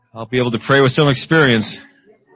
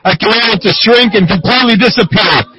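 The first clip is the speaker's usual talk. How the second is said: laying a curse; 8 semitones higher, 6 dB louder than usual. Real, not acted. Commanding cyst to die.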